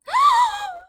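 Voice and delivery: high, loud gasp; sudden startled intake of breath; sharp gasp. Real, not acted. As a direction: gasp loudly, high-pitched. Sudden gasp while building.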